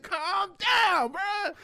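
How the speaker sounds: high, loud, hammed-up yell; retold exasperated holler; exasperated shout. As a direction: shout it to snap a friend out of panic. Retold outburst at panicking friend.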